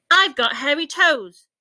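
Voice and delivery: high, loud proud shout. A narrator's reading. Ike the monkey shouting his news to the jungle. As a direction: yell it out, full of delight. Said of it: "shouted Ike".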